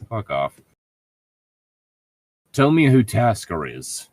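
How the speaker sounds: ordinary delivery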